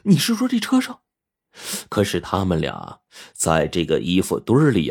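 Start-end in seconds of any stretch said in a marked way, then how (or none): 0.1-1.0 high-pitched, amazed query; startled young woman's high voice; astonished question. A narrator's reading. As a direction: ask it in disbelief. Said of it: "小琴就惊讶地说".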